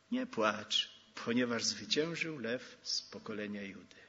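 gentle, hushed reassurance; quiet echo of the cry. Acted. Same words repeated softly.

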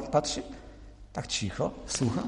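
softly, as if at a deathbed; hushed. A narrator's reading. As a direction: drop to a hush as if listening for breath. Hushed tale of father's silent death.

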